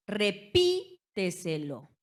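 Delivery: "Repíteselo" lento, con PÍ fuerte y alargada; énfasis en la sílaba tónica. Shown as a demonstration. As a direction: golpea el PÍ de "Repíteselo" y haz una pausa. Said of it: "donde la sílaba tónica va a recaer". ("Repíteselo" silabeado: re-PÍ-teselo)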